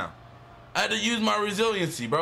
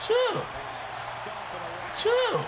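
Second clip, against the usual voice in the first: squeaky falsetto begging, much higher; high-pitched pleading; 12 semitones higher, slower than usual. Pretend. Gamer begging the opponent to stop, falsetto pleading.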